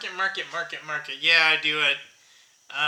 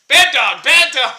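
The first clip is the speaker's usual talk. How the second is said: loud, high sharp scolding of the dog; 7 semitones higher, 12 dB louder than usual. Real, not acted. Owner scolding his dog while filming, loud and sharp. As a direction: shout it to stop the dog.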